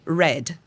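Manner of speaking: "red" clipped short, no vowel after the d; "red" kept short so it doesn't become "ready"; short English word. Shown as a demonstration. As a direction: cut "red" off sharply at the d. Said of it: "É".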